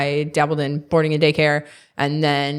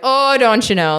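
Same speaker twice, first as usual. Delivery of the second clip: Minnesota accent; 5 semitones higher, 5 dB louder than usual. Shown as a demonstration. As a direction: do a hammy Minnesota accent as a party trick. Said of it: "I can do one as, like, a really bad party trick".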